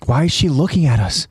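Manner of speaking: understated acted alarm; mild gasping alarm. A narrator's reading. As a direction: puzzle it out with growing unease. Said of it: "Armin gasps".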